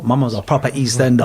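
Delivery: usual speaking voice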